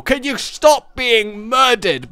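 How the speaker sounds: loud, slow yell out a window. Pretend. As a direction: call out to the neighbours' kids. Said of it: "lean out my window and go".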